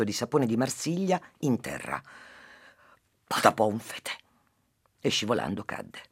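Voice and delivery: lively storytelling to a listener; story narration. Acted. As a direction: tell the fable with animated character voices. S0 tells a fable. (narrazione di una fiaba)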